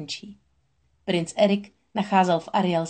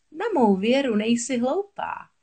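slow, high, mocking witch praise; cackling sea witch; 6 semitones higher than usual. A narrator's reading. Witch approving as Ariel signs, lightly cackling. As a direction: draw it out high, lightly gloating. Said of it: "zachechtala se mořská čarodějnice".

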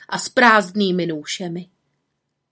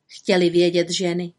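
ordinary delivery, nothing marked

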